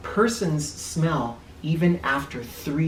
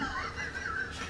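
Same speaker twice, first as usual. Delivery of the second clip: high giggle; 14 dB quieter than usual. Real, not acted. Laughing at own joke.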